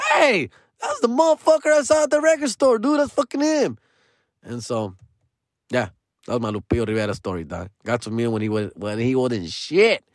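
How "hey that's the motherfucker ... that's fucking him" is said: much higher, amazed recognition; high, amazed shout of recognition; retold excitement. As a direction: point him out excitedly.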